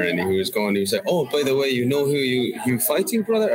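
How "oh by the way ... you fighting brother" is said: put-on German accent. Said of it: "in his German accent there".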